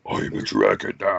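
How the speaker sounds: low breathy monster growl; lightly voiced monster; generic monster voice. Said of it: "generic monster voice".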